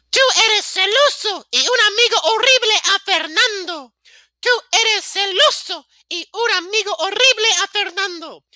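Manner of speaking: put-on loud, accusing girl in high falsetto. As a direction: hurl the accusation in a hammed-up girly shriek. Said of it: "Girl voice on".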